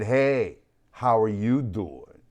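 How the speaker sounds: slow, put-on awkward hello; awkward greeting. Retelling his hello to the head honcho, awkward. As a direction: roll in awkwardly with a drawn-out greeting. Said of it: "I didn't say it like that. I'm saying it like that now".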